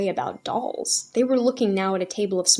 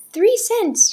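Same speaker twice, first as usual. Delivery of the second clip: high, bright hopeful bid; girl bidding hopefully; 13 semitones higher, 8 dB louder than usual. A narrator's reading. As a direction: bid with eager hope. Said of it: "she bid hopefully".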